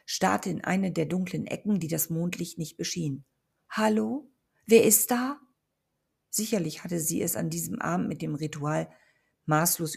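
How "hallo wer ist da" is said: higher, louder startled call; frightened call. A narrator's reading. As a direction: call out scared into the dark. Emma calls into the dark.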